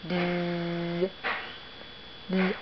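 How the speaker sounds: a soft, playful bzz like a little bee; bee buzz imitation. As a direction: buzz it gently for the child. Parent voicing a bee.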